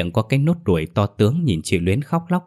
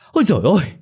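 high, loud shriek of horror; terrified cry; 10 semitones higher, 5 dB louder, much faster than usual. Acted. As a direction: cry out as you recoil in shock. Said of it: "Người này rú lên một tiếng".

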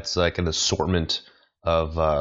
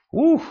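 high, loud "woo"; mock celebratory whoop; 6 dB louder than usual. Comedian after his Trump pun, mock-triumphant whoop. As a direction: whoop at your own pun.

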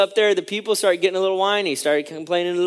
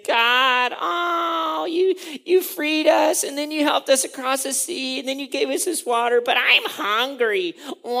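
whining and complaining; 8 semitones higher than usual. Acted. Hungry Israelites complaining to God, high exaggerated whining. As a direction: bawl it like a mock crybaby. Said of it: "they're, like, whining and complaining".

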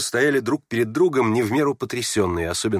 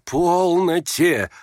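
higher, long-drawn plea to stop; restraining appeal; 7 semitones higher, 3 dB louder, much slower than usual. Acted. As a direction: stretch it out to calm them down. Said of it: "унимали Птицын и Варя". (протяжно унимая)

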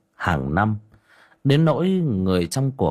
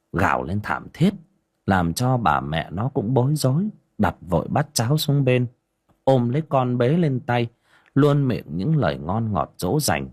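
ordinary delivery, nothing marked

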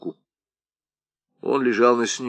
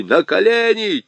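«на колени» yelled much higher and louder; furious shout; 12 semitones higher, 5 dB louder than usual. Acted. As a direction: scream «на колени» at the top of the voice. Аргылов требует встать на колени. («на колени» сорвавшимся на крик голосом)

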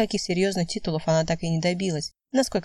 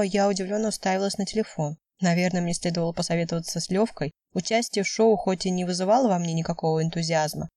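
plain reading voice

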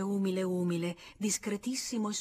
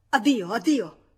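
loud, high cry of goodbye; shouted farewell; 4 semitones higher, 10 dB louder than usual. Acted. Georges fleeing the room, shouting goodbye. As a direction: fling the farewell out as he flees. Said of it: "gridò".

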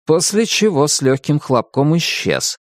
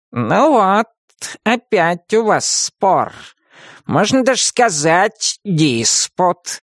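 gleeful cat; 8 semitones higher, much slower than usual. A narrator's reading. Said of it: "радостно потёр лапы кот".

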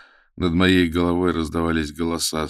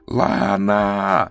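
louder, desperate scream; 4 semitones higher, 7 dB louder than usual. A narrator's reading. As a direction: scream her name. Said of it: "Мой крик".

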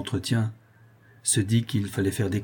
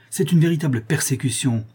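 raised, loud, defiant protest; indignant outcry; 5 semitones higher, 5 dB louder than usual. A narrator's reading. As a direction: refuse to submit, voice pushed up. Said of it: "s'écria-t-elle".

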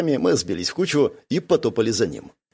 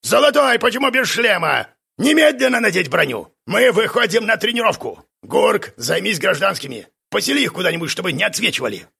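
drill instructor shouting; 9 semitones higher than usual. A narrator's reading. Instructor Kars yelling orders at his squad. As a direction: bark orders at the recruits.

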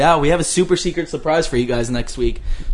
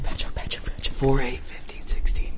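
whispered chant; 3 semitones lower, 8 dB quieter than usual. Acted. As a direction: whisper the numbers.

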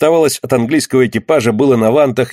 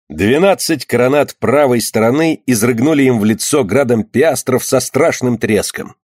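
plain reading voice

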